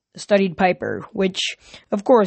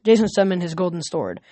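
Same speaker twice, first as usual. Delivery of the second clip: ordinary delivery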